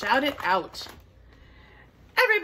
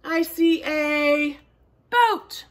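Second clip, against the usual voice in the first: ordinary delivery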